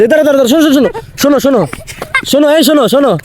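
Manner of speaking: high calling out after them; yelling across the room. Real, not acted. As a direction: shout for them to wait. Calling after the leaving kids.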